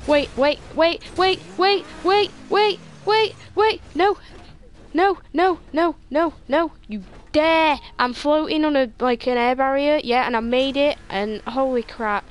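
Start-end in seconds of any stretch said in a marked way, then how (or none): 0.1-4.2 building from high to much higher, louder, each "wait" climbing; real rising panic as the car slips; panicked pleading. Real, not acted. Racing game, about to fall. 4.9-7.8 high, loud, desperate "no"s; real panicked protest. Real, not acted. Car slipping off track.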